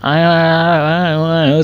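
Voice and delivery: high, very slow drawn-out aa; baby babbling. Retold or imitated. Teacher imitating a small child babbling, high and drawn out. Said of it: "क्या कहता है".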